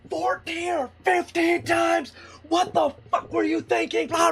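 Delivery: high, strained outraged echo; acted furious yell at moderate volume; retold yelling. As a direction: imitate an admiral exploding in disbelief. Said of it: "starts yelling at him again".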